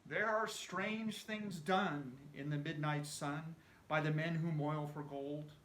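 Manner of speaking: ordinary delivery, nothing marked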